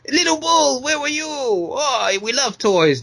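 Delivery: high, drawn-up cooing to a bull; mock bullfighters. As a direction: squeal it like delighted toy-pitch characters. Voicing bullfighters greeting little bull.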